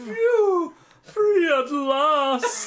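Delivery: high, loud, slow hammed-up sigh of relief; dramatic relief. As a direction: ham up a grand escape. Mock relief after escaping.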